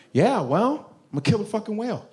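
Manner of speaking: usual speaking voice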